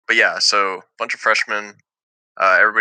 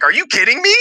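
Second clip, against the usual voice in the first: much higher, louder incredulous cry; disbelief; 4 dB louder, faster than usual. Retold or imitated. Retelling his reaction to the friend's warning, incredulous. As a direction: throw it back in mock outrage.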